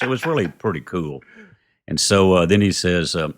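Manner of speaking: ordinary talk, nothing marked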